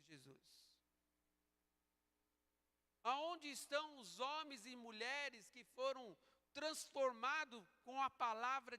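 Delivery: full-force preaching. Real, not acted. Preacher challenging the congregation on true followers of Christ, forceful preaching.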